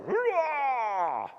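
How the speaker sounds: high, drawn-out "rah" cheer; imitated crowd roar. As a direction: imitate a roomful cheering at once. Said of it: "did you hear how it was like".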